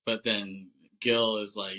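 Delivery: normal talking voice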